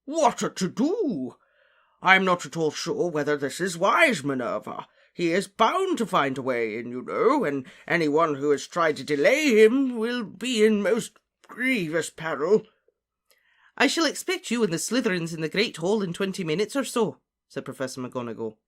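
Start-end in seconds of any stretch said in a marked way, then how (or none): 0.0-12.7 blustery character voice full of dismay; puffing, flustered professor. Acted. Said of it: "he puffed". 13.8-17.2 higher, louder voice of authority; raised, businesslike character voice; brisk commanding professor. Acted. McGonagall gives orders in story.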